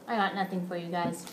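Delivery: ordinary talk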